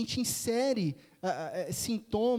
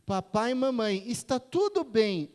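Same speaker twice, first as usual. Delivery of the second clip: loud, slowed-down reassuring announcement; raised, deliberate mock official voice; camp counselor's introduction; 2 semitones higher, 6 dB louder than usual. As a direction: imitate a camp counselor projecting reassurance to parents. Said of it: "A tia do acampamento faz uma introdução".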